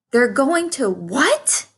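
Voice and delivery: a panicked piglet blurting it out; shocked scream. A narrator's reading. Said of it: "screamed Wilbur".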